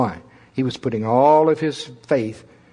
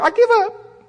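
whiny surrender; 3 dB louder than usual. Retold or imitated. Giving up under attack.

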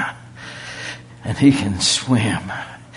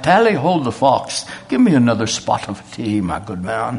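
put-on British accent. Preacher imitating the magnate on his return, British accent. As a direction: play a hearty mock-British gentleman. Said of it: "that changed the accent".

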